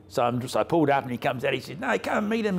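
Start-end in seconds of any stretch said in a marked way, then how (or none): 1.8-2.6 imitating Den in a much higher voice; a high mock call to come over; old Den's voice. Den urging Rob to meet the owner, voiced high.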